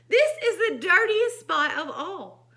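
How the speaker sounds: loud, high dismay; appalled storybook farmer's wife; shocked cry. A narrator's reading. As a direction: exclaim at the filth, hammed up for children. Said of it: "cries the farmer's wife".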